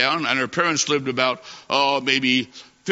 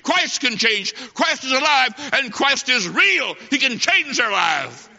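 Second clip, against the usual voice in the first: rising to a fervent climax, pressing the crowd; fiery preaching; 6 semitones higher than usual. Acted. Preacher's rising altar appeal.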